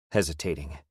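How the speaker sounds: usual speaking voice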